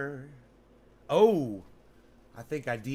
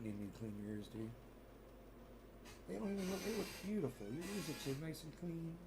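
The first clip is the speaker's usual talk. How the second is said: much softer, tender praise to the dog; soft cooing; 3 semitones lower, 16 dB quieter than usual. Real, not acted. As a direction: murmur sweet praise. Cooing softly at his dog.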